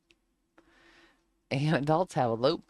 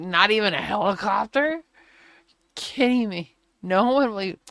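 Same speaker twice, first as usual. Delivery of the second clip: louder, higher, outraged; outraged disbelief; 5 semitones higher, 7 dB louder than usual. Retold or imitated. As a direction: rail at the refusal. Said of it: "I was like".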